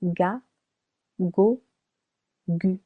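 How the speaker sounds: hard g as in good on "ga, go, gu"; hard G demonstration. Shown as a demonstration. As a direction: say "ga, go, gu" with a hard g, each separate. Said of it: "It would sound".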